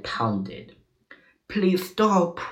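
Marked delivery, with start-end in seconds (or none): 1.5-2.4 high-pitched pained plea; acted begging, pitched up; pleading cry. A narrator's reading. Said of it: "cried the monkey".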